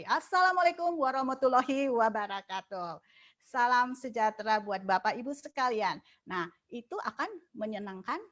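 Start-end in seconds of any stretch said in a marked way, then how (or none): 0.0-6.0 louder, firm "Assalamualaikum" leading a meeting; firm energetic greeting. Shown as a demonstration. Meeting leader greeting the audience, loud and firm. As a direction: lead the meeting's opening with confident energy. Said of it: "itu sangat bagus sekali".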